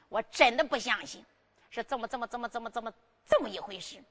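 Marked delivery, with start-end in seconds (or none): none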